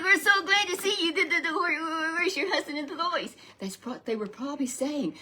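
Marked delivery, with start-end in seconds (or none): 0.0-3.3 much higher, louder gushing welcome; excited greeting. Retold or imitated. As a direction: squeal with delight at an old friend. Townspeople greeting Naomi.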